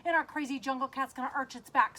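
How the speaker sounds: usual speaking voice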